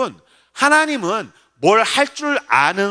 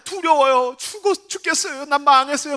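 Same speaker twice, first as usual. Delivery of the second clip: high-pitched fearful lamenting; acted panicky complaint to God; 6 semitones higher than usual. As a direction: wail that you are doomed. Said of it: "한탄".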